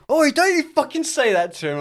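high, loud mock girl telling him off; girl at the bar tells him off, high and loud; scolding girl.

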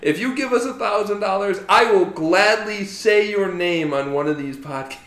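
laughing, high and loud; bursting with glee; excited. Real, not acted. Offering a shout-out.

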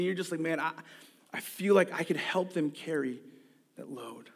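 soft, earnest pastoral voice; quiet sermon. Acted. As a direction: speak gently to those who are struggling. Quiet reflective sermon close.

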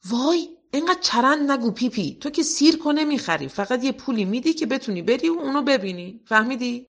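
put-on a boy losing patience with his friend; Tommy telling Pippi to stop talking nonsense; exasperated boy.

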